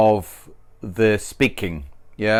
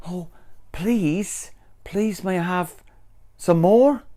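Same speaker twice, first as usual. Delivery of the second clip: higher, soft begging; pleading; 5 semitones higher, 3 dB quieter than usual. Shown as a demonstration. Teacher demos asking nicely for something. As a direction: plead sweetly for more. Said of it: "want to ask for something".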